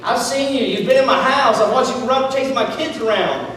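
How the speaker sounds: high-pitched, driving sermon delivery; impassioned preaching. Real, not acted. Preacher challenging the congregation about their own faith, impassioned. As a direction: press it home to the congregation with rising conviction.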